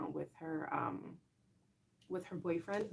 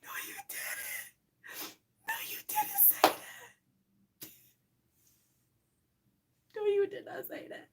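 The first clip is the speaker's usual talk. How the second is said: high, whispery laugh-crying disbelief; laughing so hard it squeaks; real tearful laughter; slower than usual. Real, not acted. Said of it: "Y'all gonna make me cry".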